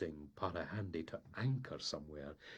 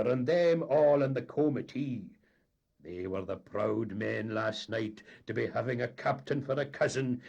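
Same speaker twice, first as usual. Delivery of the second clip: loud, higher, swaggering; boastful captain; 6 semitones higher, 9 dB louder than usual. A narrator's reading. Captain bragging to the mate about the ball, loud. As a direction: crow about the cousins.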